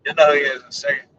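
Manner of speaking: usual speaking voice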